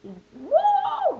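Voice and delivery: high, loud party whoop; shrieking party woo; loud excited woo. Shown as a demonstration. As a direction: cheer like you're the fun one at a party. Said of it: "I like loud".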